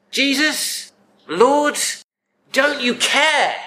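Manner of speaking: high-pitched, drawn-out indignant reproach; angry accusation. Acted. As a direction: accuse him, slowly and indignantly. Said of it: "And she accuses Jesus".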